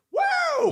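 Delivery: squealed high Woo, like the ad's tag; high Woo cry. Retold or imitated. Quoting the diamond ad slogan, high Woo cry. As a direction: yelp a shrill Woo. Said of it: "their slogan or is their, like, catchphrase".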